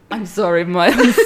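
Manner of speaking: loud, laughing quote. Real, not acted. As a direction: laugh through the line. Quoting the line, laughing.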